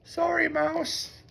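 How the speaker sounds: high, slow, soft apology; a sheepish little mole sorry. A narrator's reading. Mole apologizes to Mouse, high and slow. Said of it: "whispered Mole".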